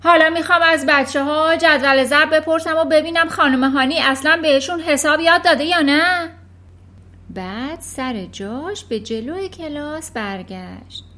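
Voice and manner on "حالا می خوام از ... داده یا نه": loud, higher, bossy headmistress. Acted. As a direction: boom it out like a tyrant headmistress. Trunchbull's voice, unannounced. (صدای بلند و زورگوی مدیر)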